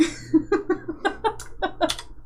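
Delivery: unvoiced airy giggle; a silent wheeze of laughter; breathy wheezing laugh. Real, not acted. Laughs after joke.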